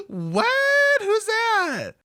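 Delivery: squeaky high mock confusion; clueless party girl. Party girl confused at the bar, hammed-up high squeal. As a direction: ask it airheaded and high. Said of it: "she's gonna be like".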